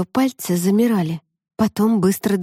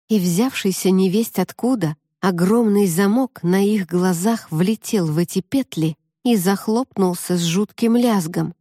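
ordinary delivery, nothing marked

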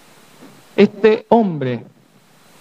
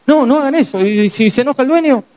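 much higher, anxious protest; panicky plea; 8 semitones higher than usual. Retold or imitated. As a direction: beg them to stop in mock alarm. Paralytic begging friends to stop.

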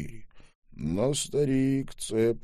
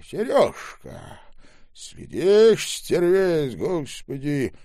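put-on higher, louder indignant old man; old man caught by the boy, higher and loud; angry old man; 7 semitones higher, 6 dB louder, slower than usual. A narrator's reading.

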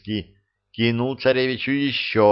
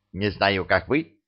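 ordinary delivery

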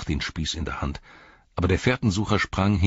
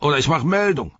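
higher-pitched orc soldier's yell; bellowed threat; 11 semitones higher, 7 dB louder, faster than usual. Acted. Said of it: "brüllte der Soldat".

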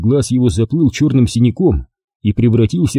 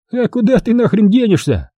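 higher, mocking sneer; sneering grin; 11 semitones higher than usual. A narrator's reading. Commander sneering at the cornered prisoner. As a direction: jeer at him with a grin. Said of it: "осклабился комбриг".

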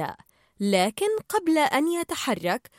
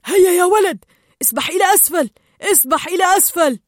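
loud, raised urging commands; urgent shouting; 6 semitones higher, 8 dB louder, faster than usual. A narrator's reading. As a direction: drive the kid on with shouted orders. Urging the kid to swim down.

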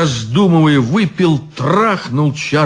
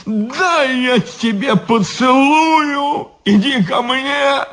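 put-on drunken affection; 12 semitones higher, slower than usual. Drunk guard embracing devil.

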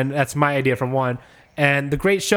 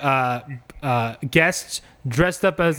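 ordinary talk

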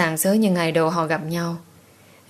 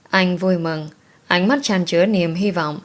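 usual speaking voice

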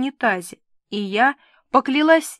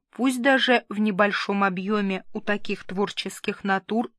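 usual speaking voice

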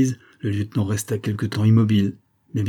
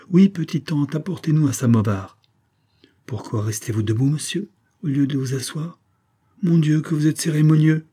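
lightly voiced playful girl, a bit higher; lively young woman; 6 semitones higher than usual. Emilie teasing the stiff officer, a lightly voiced playful girl. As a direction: tease him gently, bubbly.

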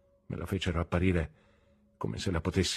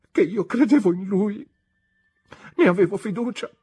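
climbing far higher and louder to a cry; desperate confession; 8 semitones higher, 5 dB louder than usual. A narrator's reading. Emily defends her love before Rosa, anguished cry. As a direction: break open with love and despair.

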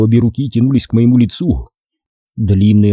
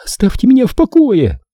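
put-on thin childish wail; 12 semitones higher than usual. Corola wails at him in a childish voice. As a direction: wail like a petulant little girl. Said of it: "завопила она тонким детским голоском".

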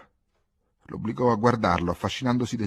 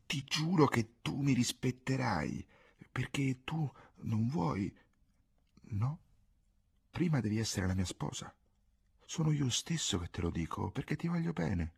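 innocent air; 4 semitones higher, 5 dB quieter than usual. A narrator's reading. Ghisola swearing to Pietro he will respect her, innocently. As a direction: promise it gently, as if guileless. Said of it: "con un'aria innocente disse".

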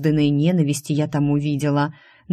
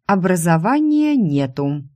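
plain reading voice

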